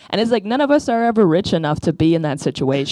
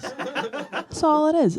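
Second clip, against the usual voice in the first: laughing; 10 semitones higher, much slower than usual. Retold or imitated. Repeated in a different voice.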